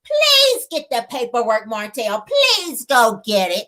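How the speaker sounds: slow, drawn-out taunting please; mock pleading. Melody dares Martell to fetch papers, mock pleading. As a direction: stretch each please, daring him. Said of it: "Melody says".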